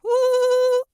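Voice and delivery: trembling high howl with tight lips; shivery howl. Shown as a demonstration. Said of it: "It's gonna shiver".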